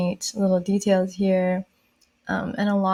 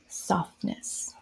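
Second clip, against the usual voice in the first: a much softer whisper showing softness; 10 dB quieter than usual. Shown as a demonstration. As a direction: breathe the word out softly. Softly illustrating a soft look.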